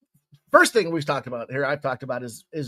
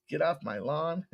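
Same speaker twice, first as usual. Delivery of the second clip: hint of a tough-guy film star, softer; Clint Eastwood; 7 dB quieter than usual. Podcast host tries a film catchphrase, lightly imitated tough guy. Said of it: "I don't have a Clint Eastwood".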